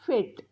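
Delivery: फेट with a long "ay" and silent final e; correct pronunciation. Shown as a demonstration. Teacher sounding out the spelling for students, correct pronunciation. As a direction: say फेट as one syllable, "fayt". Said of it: "आपण फाटे नाही बोलत".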